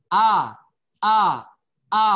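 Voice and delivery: "a" said open and loud, not like e; teacher models a clear open a. Shown as a demonstration. Teacher drills a clear open a for the class, loud. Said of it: "Jangan e".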